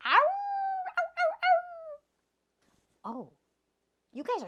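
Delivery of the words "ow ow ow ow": high, drawn-out coyote howl; playful howl for the kids. Pretend. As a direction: throw back your head and howl like a coyote. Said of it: "Can you howl like a coyote?".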